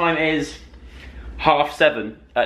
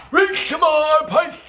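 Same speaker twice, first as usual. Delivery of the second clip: high, stretched-out silly squawk; silly put-on voice; 13 semitones higher, slower than usual. Vlogger repeating an ingredient for fun, silly voice. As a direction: call it out slow and very high.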